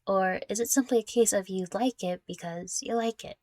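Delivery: ordinary delivery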